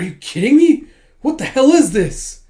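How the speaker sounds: high, loud indignant outburst; acted-out angry disbelief; outraged protest. Retold outrage at being handcuffed.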